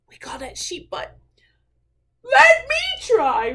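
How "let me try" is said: high, loud, drawn-out cheer; eager whoop. Acted. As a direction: whoop it like a keen little bear. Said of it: "whooped Douglas".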